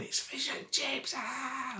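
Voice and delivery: high, choked cry of disgust under his breath; high squeal of disgust. Retold or imitated. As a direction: squeal as if smothered by a bad smell. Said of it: "I'm going".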